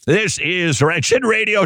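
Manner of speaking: slow, high Minnesota accent, like Fargo. Shown as a demonstration. Radio host shows off his Minnesota accent, slow and high. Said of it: "Just like that, I slip into a Minnesota accent".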